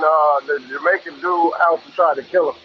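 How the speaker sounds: usual speaking voice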